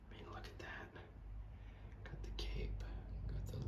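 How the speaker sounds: very soft whispered marvelling; hushed admiration. Real, not acted. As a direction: whisper while admiring fine detail. Hushed admiring of the rogue figure.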